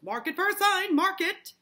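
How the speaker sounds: high, loud, dramatic warning; urgent proclamation. Acted. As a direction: declare the omen at full volume. Putnam declares omen, acted.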